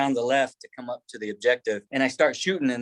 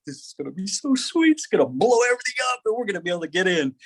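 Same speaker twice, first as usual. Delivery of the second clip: eager; 7 semitones higher, faster than usual. Retold or imitated. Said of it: "I'm like".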